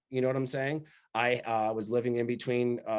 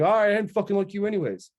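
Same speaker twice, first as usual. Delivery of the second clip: mock dismissive; 7 semitones higher, 6 dB louder, faster than usual. Imagined dad handing him over.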